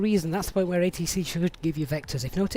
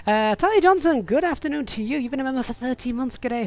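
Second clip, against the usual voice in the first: excited greeting; 7 semitones higher, 10 dB louder than usual. Real, not acted. Greeting new member loudly.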